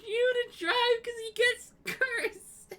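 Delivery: high, squeaky laugh-choked voice; crying with laughter. Real, not acted. Cracking up at own Petey scenario, crying with laughter. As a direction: barely get it out through real laughter. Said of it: "I'm actually crying".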